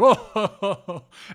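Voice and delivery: loud, high belly laugh; mock laughter. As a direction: let out a big, booming storyteller's ho-ho laugh. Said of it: "They hear some laughter".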